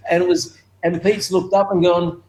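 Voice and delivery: ordinary delivery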